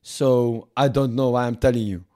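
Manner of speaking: hammed-up French accent, lightly put on; mock French accent. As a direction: play a pompous French negotiator in a mock accent. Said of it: "Fuck this French accent".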